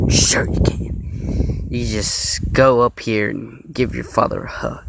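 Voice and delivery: slow, breathy attempt at a firm father; a put-on dad voice, dragged out; determined voice. Father answering his son, slow and determined. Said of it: "with a determined voice".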